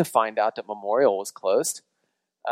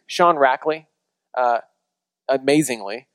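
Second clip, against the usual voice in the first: ordinary talk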